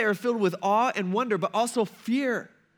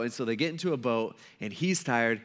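ordinary delivery

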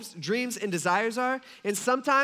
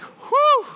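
big high shouted whew of relief; loud relieved whew; 11 dB louder than usual. Retold or imitated. Relief after checking his list.

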